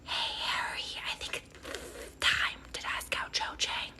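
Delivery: slow whispered snake voice; whispering snake. Acted. Pet snake nudges Harry to ask out Cho, slow whisper. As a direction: draw it out in a snaky whisper.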